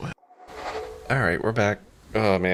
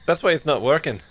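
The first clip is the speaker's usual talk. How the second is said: lightly put-on Scottish accent; bad Scottish accent; 2 semitones higher than usual. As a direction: have a go at sounding Scottish, badly. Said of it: "five minutes of bad Scottish accent".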